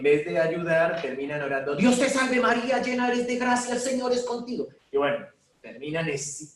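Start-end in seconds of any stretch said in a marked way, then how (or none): 1.8-4.7 much higher and louder, rushed and agitated; heated prayer. Shown as a demonstration. Priest mocking people praying heatedly over someone who collapsed, frantic and high. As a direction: fire it off heatedly, pitched way up.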